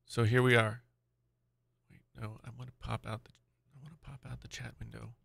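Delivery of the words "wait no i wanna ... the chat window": whispered to self while busy; under-the-breath whisper. Real, not acted. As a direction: whisper it as you click around. Muttering while fiddling with settings.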